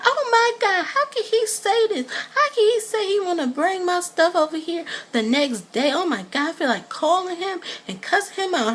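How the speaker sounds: high, dramatic, exasperated voice; mimicking Portia upset. Vlogger imitates Portia upset about Cordell, high and dramatic. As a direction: squeal it like an outraged diva. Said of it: "She was like".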